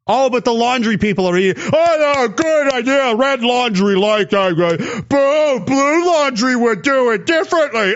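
high, hammed-up ninny voice; mocking supporter voice. Mocking imagined supporters of rival parties.

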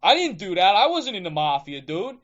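loud, high New York Italian rant; New York Italian accent, indignant and loud; pissed-off New York Italian. Retold or imitated. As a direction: play an offended New York Italian guy yelling. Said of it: "Italian guy in New York get all pissed off".

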